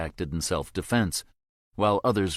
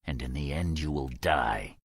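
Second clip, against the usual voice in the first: lower, quiet cold menace; through clenched teeth; 4 semitones lower, 4 dB quieter than usual. A narrator's reading. Soldier telling the prisoner he will die, low and cold. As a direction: promise his death coldly. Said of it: "teeth clenching".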